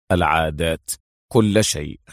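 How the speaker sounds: low, firm end of a list; serious. A narrator's reading. Adham finishes his orders to Mona, serious. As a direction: close the list gravely. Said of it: "قال أدهم بجدية".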